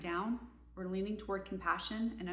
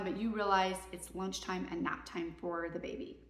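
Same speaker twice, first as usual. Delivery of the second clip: ordinary talk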